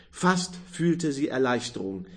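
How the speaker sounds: plain reading voice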